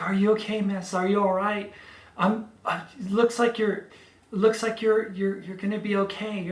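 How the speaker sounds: calm her while sounding the alarm; reassuring rescuer. Retold or imitated. Rescuer climbs down and reassures the injured driver, loud and urgent.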